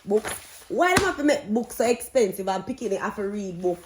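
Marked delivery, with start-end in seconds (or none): none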